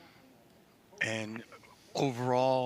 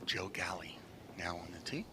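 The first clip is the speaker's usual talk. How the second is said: whispered golf announcer; hushed whisper; 6 semitones lower, 7 dB quieter than usual. Real, not acted. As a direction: keep it hushed at the tee.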